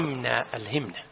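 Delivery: ألهمنا said alhimna, kasra on the ha; corrected vowel. Shown as a demonstration. Sheikh corrects the student's reading: ألهمنا with kasra, quietly. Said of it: "correcting student's reading".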